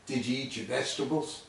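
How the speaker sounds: quiet, stern motherly question; stern mom. Retold or imitated. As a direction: ask it like a suspicious mother. Waitress squints, stern question.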